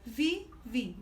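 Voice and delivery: "Vivi" broken into vi, vi; two separate, even beats on "Vivi"; split into syllables. Shown as a demonstration. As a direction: articulate each syllable of "Vivi". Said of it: "Você separa".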